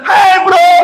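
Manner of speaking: high, yelled-out mall greeting; mock shouted greeting. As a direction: imitate someone hailing a buddy across a mall. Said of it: "bukan yang teriak".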